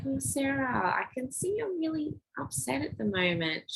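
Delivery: put-on gentle comforting voice to a crying child; calm her like a kind helper; softly lifted, tender reassurance. Soothing upset girl in meltdown.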